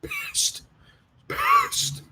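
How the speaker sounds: tiny, very high, soft squeak on "pissed"; comic squeak. Acted. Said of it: "I was".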